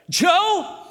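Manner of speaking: high, loud aggrieved griping; whiny complaint. Retold or imitated. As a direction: complain bitterly to your ally. Ahab complaining to Jehoshaphat.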